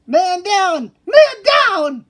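high, loud urgent calls; alarmed shout. Acted. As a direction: call out in alarm. Alarm call.

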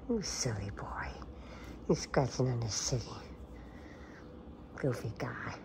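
soft whispered pet name. Real, not acted. Owner whispering to her cat, whisper. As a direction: whisper it fondly.

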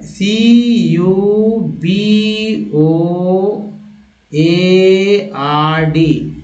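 letters spelled very slowly one by one; spelling out. Shown as a demonstration. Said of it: "लिखेंगे P के साथ ही".

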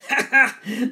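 short hearty laugh; chuckle. Acted. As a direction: laugh like a pleased dingo. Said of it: "Dingo laughed".

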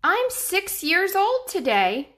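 put-on high, loud little boy voice; child voice. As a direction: pitch it up like an eager six-year-old. Danny the boy speaks.

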